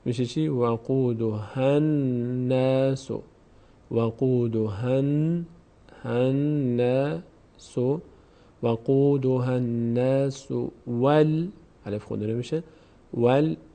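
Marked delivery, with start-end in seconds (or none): 0.5-11.5 وقودها الناس with ها joined straight to doubled ن; slow recitation demo. Shown as a demonstration. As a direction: slowly link وقودها into الناس. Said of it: "میچسبه مستقیم به نون".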